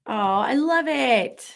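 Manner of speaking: normal talking voice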